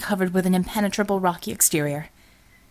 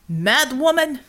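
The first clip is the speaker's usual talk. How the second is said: higher, indignant shout; shouted insult; 5 semitones higher, 3 dB louder, slower than usual. A narrator's reading. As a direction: hurl the insult back as he leaves. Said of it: "he shouted".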